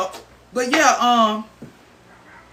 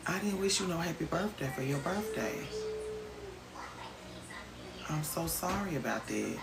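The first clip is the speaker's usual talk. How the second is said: softer, sincere sorry; quiet apology; 12 dB quieter than usual. Real, not acted. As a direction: apologize softly. Apologizing for missed birthday.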